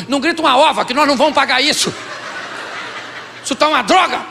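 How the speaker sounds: acted indignant restaurant rant; irritated dominant spouse. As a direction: refuse to be hushed, raising your voice. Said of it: "o dominante fica mais irritado".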